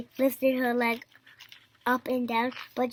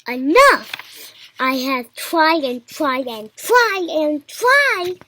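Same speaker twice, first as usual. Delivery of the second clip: loud, drawn-out, building complaint; exasperated shout; 4 semitones higher, 7 dB louder, slower than usual. A narrator's reading. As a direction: stretch each "tried" in frustration. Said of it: "shouted Elephant".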